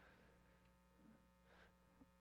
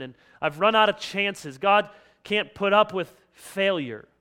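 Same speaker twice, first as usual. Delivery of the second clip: loud, higher, rushed self-reproach; exasperated listener; 4 semitones higher, 7 dB louder than usual. Acted. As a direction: voice someone fed up with their own failing. Voicing a frustrated listener giving up.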